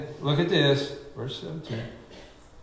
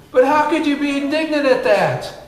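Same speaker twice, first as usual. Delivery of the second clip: loud, high excited preaching; yelling; 7 semitones higher, 3 dB louder than usual. Real, not acted. Pastor yelling to his church in excitement. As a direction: yell it out in amazement. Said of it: "I don't know why I'm yelling today".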